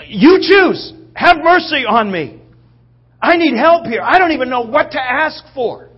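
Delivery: loud, high cry for help; desperate plea. Acted. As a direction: cry out helplessly at full voice. Acts out beggar's desperate cry for help.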